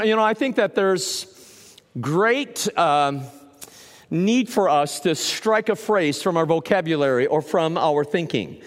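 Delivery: ordinary delivery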